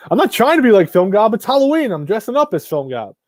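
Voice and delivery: high-pitched, loud, laughing denial; amused, squealing self-defence; laughing protest. Real, not acted. Laughing protest at a viewer's teasing.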